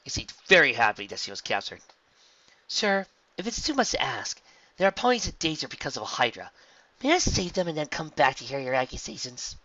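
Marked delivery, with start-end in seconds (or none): 2.7-9.6 high, earnest polite request; polite heroine. A narrator's reading. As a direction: plead respectfully with the captain. Rainbow asks captor politely.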